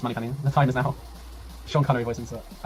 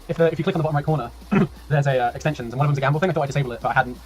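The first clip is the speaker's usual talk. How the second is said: ordinary talk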